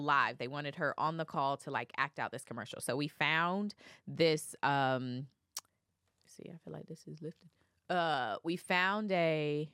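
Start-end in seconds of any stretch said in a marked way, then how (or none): 6.3-7.5 near-silent whisper to oneself; much softer, whispered mumble; whispered aside. Real, not acted. Whispered aside while recalling a name.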